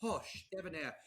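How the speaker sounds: soft posh English imitation; proper English accent. Joker mimics a posh Londoner, proper English accent. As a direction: put on a debonair English accent. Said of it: "that proper English accent".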